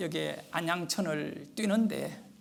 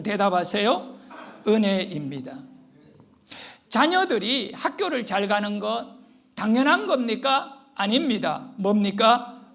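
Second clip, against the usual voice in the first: rousing call-and-response preaching; 3 semitones higher, 6 dB louder than usual. Real, not acted. Preacher prompting congregation to answer, rousing call-and-response preaching. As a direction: drill the congregation with emphatic question and answer.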